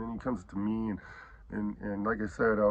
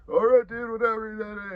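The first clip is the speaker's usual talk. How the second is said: much higher, louder lazy brush-off; dismissive buddy; 10 semitones higher, 6 dB louder, slower than usual. Retold or imitated. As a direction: toss it off like a careless buddy. Friend's brush-off, retold.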